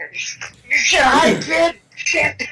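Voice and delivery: panicked cry. Acted. Panic under guns.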